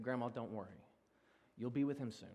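soft, low words of comfort; gentle reassurance. Retold or imitated. Pastor consoling his grieving grandma at the funeral home, softly reassuring. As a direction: console softly, keeping it hushed.

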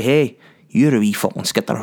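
put-on broad, punchy Scottish accent; full Scottish. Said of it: "Don't know why I went full Scottish".

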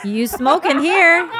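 loud, high teasing jab; teasing loud callout. Real, not acted. Friend calling out his diary word, loud teasing. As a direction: call him out, laughing loud.

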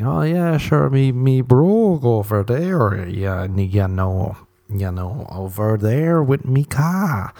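slow, drawn-out mock Irish accent; bad fake Irish accent. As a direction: mimic an American badly faking Irish, stretching the vowels. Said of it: "Americans hear the Irish accent and they start speaking like".

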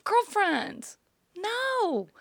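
put-on high, pitying "girlfriend, no"; knowing warning. To Mark's girls at closing time, high and pitying. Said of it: "I just look at them and I go".

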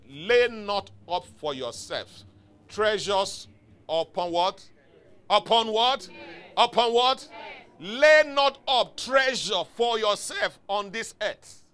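slow, emphatic scripture proclamation; preaching declamation. Acted. Preacher quizzing the congregation on a verse, emphatic proclamation.

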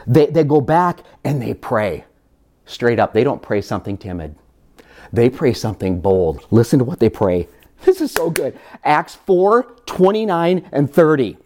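usual speaking voice

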